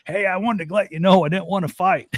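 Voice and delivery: put-on high, sheepish old-man voice; backpedaling old man. Old man seeing the younger man, backing off in a high sheepish voice. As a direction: suddenly back down, all apologies. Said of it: "He's like".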